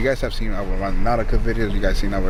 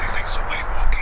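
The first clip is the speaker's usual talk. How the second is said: whispering. Real, not acted. Hearing footsteps in haunted house.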